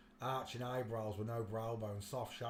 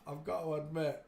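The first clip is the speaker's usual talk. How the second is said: normal talking voice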